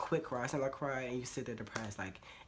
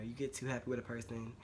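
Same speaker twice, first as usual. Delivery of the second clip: usual speaking voice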